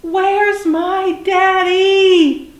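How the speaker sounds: high, drawn-out storybook call for a parent; yelling. A narrator's reading. Lost little dinosaur yells for his daddy, long high holler. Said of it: "you stay in one place and you yell".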